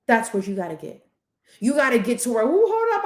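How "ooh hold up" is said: much higher startled "ooh"; mock surprise, high; sudden alert. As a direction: catch yourself mid-thought. Inner self noticing.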